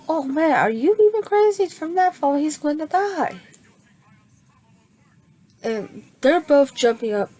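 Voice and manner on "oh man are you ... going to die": high, louder alarmed outcry; high worried shout. Retold or imitated. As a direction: panic about the fall. All Might alarmed at the fall.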